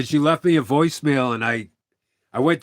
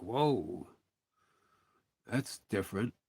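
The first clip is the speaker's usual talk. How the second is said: stunned hush; 6 semitones lower, 14 dB quieter than usual. Retold or imitated. Shocked aside at friend's coldness.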